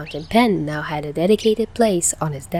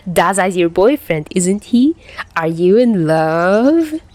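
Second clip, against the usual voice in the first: louder, slow, sing-song teasing; 5 dB louder, slower than usual. Acted. Tachihara teasing Chuya about Dazai. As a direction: drag out the last question to needle him. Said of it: "Tachihara teased".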